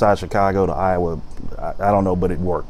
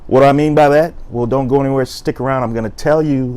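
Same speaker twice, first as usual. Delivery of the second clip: upbeat, punchy intro that climbs higher and louder; energetic host intro; 4 semitones higher, 3 dB louder than usual. Acted. Host greeting his viewers with a higher, louder hype intro. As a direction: hype the viewers up for story time.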